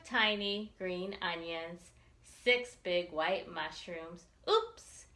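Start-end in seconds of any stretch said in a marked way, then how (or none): 4.5-5.1 sudden high yelp of surprise; playful little oops; surprised oops. A narrator's reading. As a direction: catch the mistake with a bright squeak. Child notices forgotten parsley.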